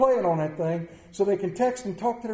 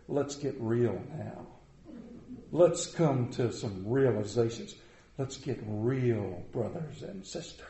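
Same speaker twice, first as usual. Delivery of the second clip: lower, slow, drawn-out preacher voice; mock TV preacher; 9 semitones lower, 6 dB quieter, slower than usual. Pastor parodying a radio preacher for his congregation, lower and slow. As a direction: ham up the radio preacher at the podium. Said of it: "I'm gonna lean up on the podium and go".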